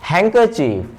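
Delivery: normal talking voice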